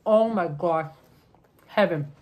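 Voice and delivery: talking between bites; chewing while speaking; mouth full, chewing. Real, not acted. Seen 0: Bites sandwich near 0.9, then chews with full mouth through 5.4.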